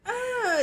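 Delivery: high, loud "ah"; mock yelp while ducking; startled cry, played up; imitated scared cry.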